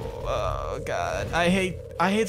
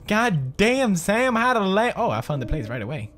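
normal talking voice

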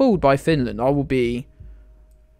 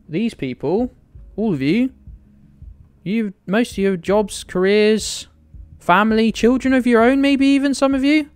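building mock outrage; 8 semitones higher than usual. Real, not acted. Streamer defending chat against a toddler jibe, building. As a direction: rant rising to a peak.

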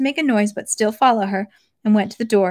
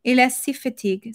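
French. Acted. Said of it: "said Sonia".

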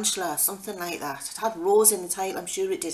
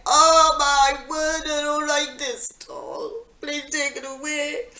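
high, slow and loud frightened plea; panicked plea; 8 semitones higher, 5 dB louder, slower than usual. Retold or imitated. Mum panicking as the tarantula starts moving, high and pleading. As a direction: beg them to take the spider away. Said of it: "I was like".

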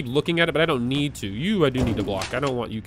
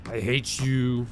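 slow, softer, lower grumble; subdued resentment; 4 semitones lower, 6 dB quieter, slower than usual. Real, not acted. Player repeating it to the lurking character, subdued resentment. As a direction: drag it out under your voice.

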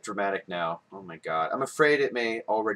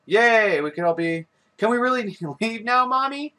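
put-on higher, hopeful little kid; child voice; 10 semitones higher, 4 dB louder than usual. Gamer voicing a child asking Mommy, higher and hopeful. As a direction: ask like a small child.

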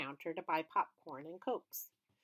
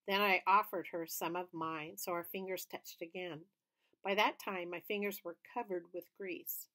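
usual speaking voice

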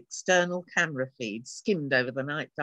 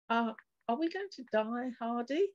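higher, hushed scared question; a touch of fear, voice raised in pitch; frightened; 7 semitones higher than usual. Acted. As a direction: ask it trembling with fear. Frightened woman asks.

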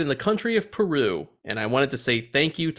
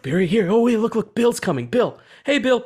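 put-on a higher, louder kid calling friends; excited kid voice; 8 semitones higher, 4 dB louder than usual. Kids in an ad greeting Bill: high excited calling. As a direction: call out to your buddy, lightly voiced kid. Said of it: "They're like".

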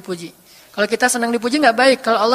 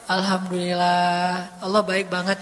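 contented thanks; 6 semitones lower, 3 dB quieter, slower than usual. Pretend. Contented after eating.

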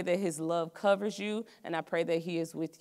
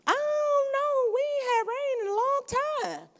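mocking doubter; 3 dB louder than usual. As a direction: mimic a sneering neighbour, pitched way up. Scoffing neighbours in Noah story.

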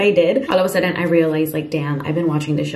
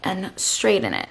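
usual speaking voice

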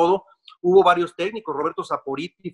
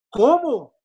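shocked disbelief; 8 semitones higher, 3 dB louder than usual. Retold or imitated. Told he is leaving the club.

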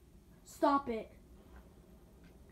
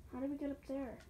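ordinary talk

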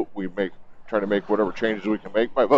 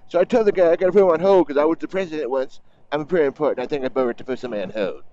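higher, hammed-up Trump storytelling voice; Trump impersonation; 6 semitones higher than usual. Biker retelling the acceptance speech, in a higher hammed-up Trump imitation. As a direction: ham up his bragging like a rally speech. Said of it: "I can't do an impersonation of anybody".

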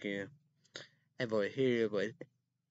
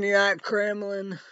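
angry taunt; 9 semitones higher, 8 dB louder than usual. Real, not acted. Yelling at Dan on screen.

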